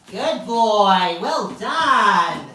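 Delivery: loud, drawn-out praise for a dog; big, hearty dog praise; excited praise. Real, not acted. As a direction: gush over the dog's success.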